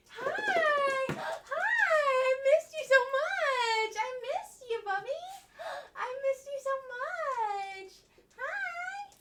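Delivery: high, loud, half crying joy; tearful excited greeting. Real, not acted. Owner hugging her long-missed dog, crying with joy. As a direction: gush through happy tears.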